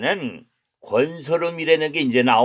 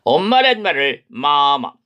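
usual speaking voice

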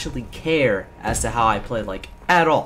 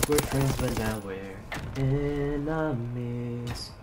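mock heartbroken; much slower than usual. Mock betrayal after being killed.

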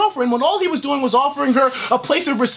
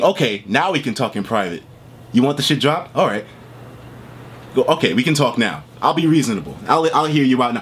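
lower, mock-reasonable Nate voice; 11 semitones lower than usual. Mimicking Nate offering to talk privately, mock calm. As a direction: sneer through a fake calm offer. Mocking Nate's sudden reasonableness.

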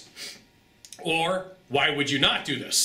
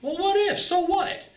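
sharp high scoffing retort; dismissive; slower than usual. Real, not acted. Coach scoffing at timid seller's worry, high and dismissive. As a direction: brush the fear aside. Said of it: "dismissive retort".

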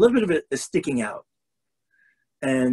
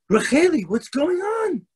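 alarmed mother; 13 semitones higher than usual. Acted. Mother finds daughter crushing crayons, high alarmed cry. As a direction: call out in shock.

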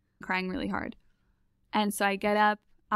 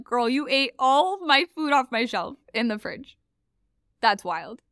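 raised, louder disbelief at the food theft; incredulous retort; 5 semitones higher, 5 dB louder, slower than usual. Retold or imitated. Telling the roommate off about her shelf, incredulous and louder. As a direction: call out the roommate in disbelief, lightly amused.

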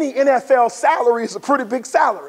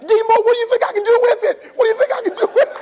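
teasing teammate; 10 semitones higher, much faster than usual. Retold or imitated. Barry teasing with huge check.

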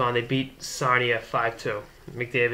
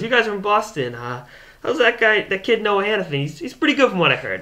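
higher, louder, chatty hockey manager voice; voicing the general manager; 9 semitones higher, 6 dB louder than usual. General manager asking the Boston visitors, upbeat and louder. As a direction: play her as a friendly, eager team exec.

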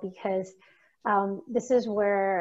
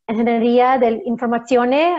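put-on Italian accent on the name, a bit louder; best Italian accent; 3 semitones higher, 8 dB louder than usual. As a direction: say it like an Italian would. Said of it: "that's my best Italian accent I could do".

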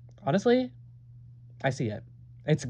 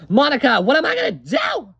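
high, loud panicked plea; panicked wail; 10 semitones higher, 6 dB louder than usual. Acted. Panicked cry after losing ring.

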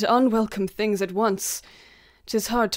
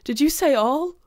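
stunned grief; 6 semitones higher, 4 dB louder than usual. Acted. Macduff hears his children killed.

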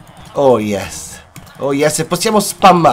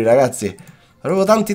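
usual speaking voice

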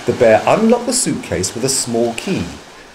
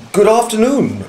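higher-pitched greeting, falling at the end; a hint of a polite gentleman replying; lightly voiced Mister Brown; 9 semitones higher than usual. A narrator's reading. Man returning the bear's greeting, lightly voiced higher-pitched reply. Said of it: "replied Mister Brown".

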